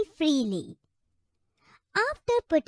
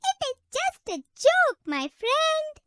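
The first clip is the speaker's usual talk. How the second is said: much higher, light parrot voice; cheeky parrot; 11 semitones higher than usual. Acted. Parrot shrugs off the elephant's anger, high cheeky voice. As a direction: squeak it out like a cheeky little bird.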